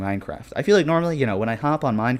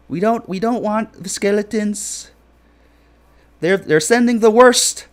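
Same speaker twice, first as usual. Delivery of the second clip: high, loud, slow put-on Indian accent ranting about creepers; hammed-up Indian accent, much higher and louder; mock Indian accent; 12 semitones higher, 7 dB louder, slower than usual. Streamer ranting about Minecraft mobs in a mock Indian accent. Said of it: "my voice went Indian there".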